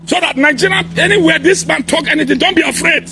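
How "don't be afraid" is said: ordinary delivery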